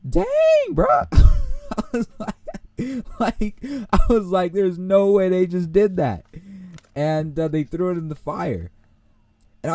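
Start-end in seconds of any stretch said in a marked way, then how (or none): 0.1-6.2 much higher and louder, slow, amazed; can't believe what you just saw; stunned disbelief. Retold or imitated. Student watching his classmate's paper burn, high stunned disbelief. Shocked as paper thrown in fire.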